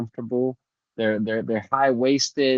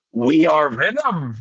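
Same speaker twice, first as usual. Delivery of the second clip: slow, loud monster growl of a voice; Venom voice; 5 semitones higher, 4 dB louder, slower than usual. Retold or imitated. Answering his girlfriend's scolding, slow Venom voice. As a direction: rumble it out like the symbiote. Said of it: "Say that voice one more time".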